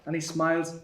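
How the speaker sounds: ordinary delivery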